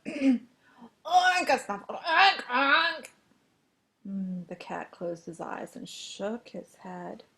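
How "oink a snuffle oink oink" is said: high, snorting oinks as a crow; loud pig oinks. A narrator's reading. Pig demonstrating crowing, loud high oinking. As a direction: oink and snuffle at full voice. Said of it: "and crow".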